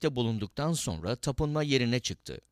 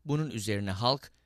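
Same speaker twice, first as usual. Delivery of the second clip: ordinary delivery, nothing marked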